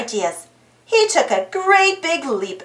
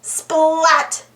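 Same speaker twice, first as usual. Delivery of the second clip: plain reading voice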